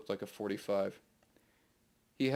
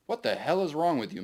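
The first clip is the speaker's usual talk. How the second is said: louder, higher angry growl; a gunman losing patience, louder; 6 semitones higher, 6 dB louder than usual. Acted. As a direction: snarl it at the strange victim. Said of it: "Maxwell growled".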